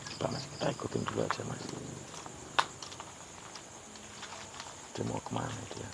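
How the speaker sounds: low, fast whisper to the cameraman; low, hurried whisper. Real, not acted. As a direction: keep it hushed while sneaking after her. Following a ghost woman quietly.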